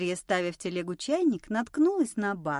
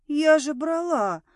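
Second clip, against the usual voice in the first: higher, drawn-out bewildered protest; taken aback; 6 semitones higher, 4 dB louder than usual. A narrator's reading. Friend sure she took the cans, bewildered. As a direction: protest in slow, stunned disbelief. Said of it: "оторопела Мила".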